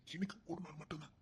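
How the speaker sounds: pleading under his breath; whisper. Acted. Husband begging his wife for one night, whispering. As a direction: whisper the promise close to her ear.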